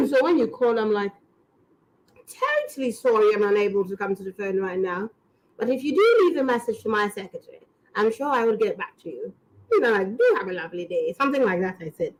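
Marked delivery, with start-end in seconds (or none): 2.7-11.1 put-on lightly done British Queen accent on a voicemail; posh Queen voicemail. Recording a voicemail greeting in a light British Queen accent. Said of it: "you sound like the queen".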